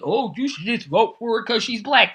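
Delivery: lightly put-on voice of the other side; mocking commenters. Host imitating commenters who urge a vote, lightly mocking. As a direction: mimic their argument with bobbing pitch. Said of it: "trying to say".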